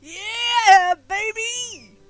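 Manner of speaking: ordinary talk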